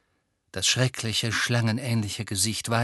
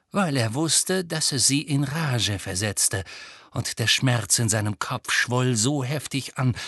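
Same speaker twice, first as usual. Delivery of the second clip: plain reading voice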